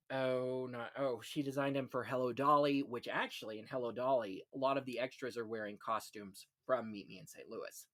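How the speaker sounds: ordinary talk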